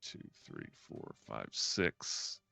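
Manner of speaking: very soft, low, half-whispered count; near-whispered tally; counting under breath. Real, not acted. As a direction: count quietly to yourself. Counting squares to oneself.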